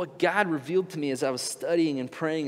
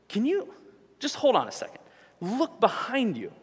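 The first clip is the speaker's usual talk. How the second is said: higher, slowing right down on look behind you; 6 semitones higher than usual. Pretend. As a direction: spell it out patiently. Pleading with oblivious crowd.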